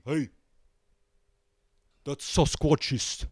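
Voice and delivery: ordinary delivery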